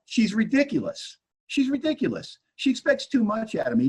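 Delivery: high, exasperated whine about the wife; resentful complaint. Retold or imitated. Acts out his resentful inventory answers.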